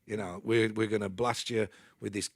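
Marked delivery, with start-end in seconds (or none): none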